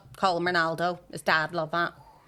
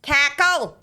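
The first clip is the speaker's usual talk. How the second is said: high, loud call with falling end; calling a child; 8 semitones higher, 9 dB louder than usual. Retold or imitated. Woman in the Kennedy Centre imitated calling her child, loudly. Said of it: "There was a woman in the Kennedy Centre before calling".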